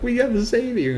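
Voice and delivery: high-pitched mock panic; imitated freaking out. Woman to man who's writing as he dies, imitated panic. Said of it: "freaking out".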